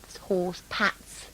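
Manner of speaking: slow whisper. Shown as a demonstration. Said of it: "Is that what you call them?".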